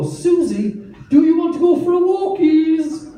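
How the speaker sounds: excited dog talk. Acted. Owner offering dog a walk, high excited pet voice. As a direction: gush at the dog about a treat.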